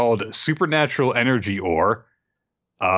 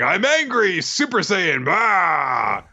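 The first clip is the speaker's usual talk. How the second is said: mock anime rage; 8 semitones higher, slower than usual. Mock powering up.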